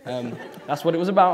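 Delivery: normal talking voice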